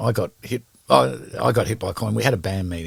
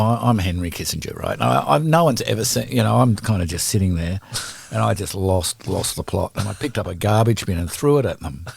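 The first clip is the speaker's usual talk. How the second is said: ordinary talk, nothing marked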